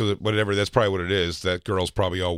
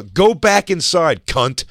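high, loud, slow retold order; angry dismissal; 7 semitones higher, 5 dB louder, much slower than usual. Fan ordering the lady back inside, retold angry dismissal. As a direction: reenact a drawn-out angry dismissal. Acted insult in retold quarrel.

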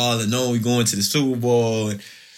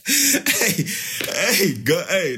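amused exclamation; 8 semitones higher, much slower than usual. Real, not acted. Laughing shrug-off exclamation.

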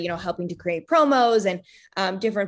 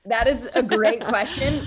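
laughing; 3 semitones higher than usual. Real, not acted. Laughing at question.

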